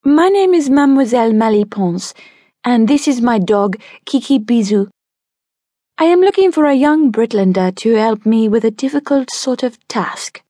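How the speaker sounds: a hint of a Francian lady's accent, poised; faint Francian accent. Mademoiselle Maliponce introduces herself, light Francian accent. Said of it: "in a faint Francian accent".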